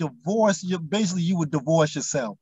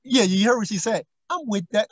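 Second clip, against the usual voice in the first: ordinary talk, nothing marked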